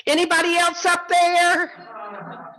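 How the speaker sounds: high, drawn-out comic yell; acted desperate call for someone else. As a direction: holler up a canyon, stretching every word. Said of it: "Jack started yelling".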